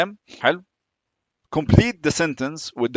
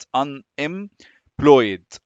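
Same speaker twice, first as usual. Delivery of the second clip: very slow "Unemployed", split into separate syllables; 3 dB louder, much slower than usual. Shown as a demonstration. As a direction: break "Unemployed" into un, em, ployed with pauses. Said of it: "لآخر syllable في ال".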